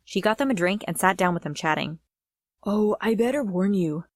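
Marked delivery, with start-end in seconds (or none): 2.6-4.0 lightly voiced fake guy; a hint of put-on boy's voice. A narrator's reading. Sister posing as Luke warns the girls, mock guy voice. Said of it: "in that guy voice of hers".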